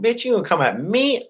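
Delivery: high taunting challenge; tough guy taunt. Retold or imitated. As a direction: dare him to try. Retold challenge to the stepdad.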